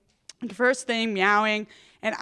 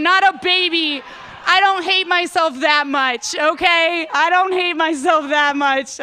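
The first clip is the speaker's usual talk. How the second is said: higher, louder, mock-defensive protest; comic loud protest; 5 semitones higher, 6 dB louder than usual. Real, not acted. Comedian protesting to the crowd about babies, loud and defensive.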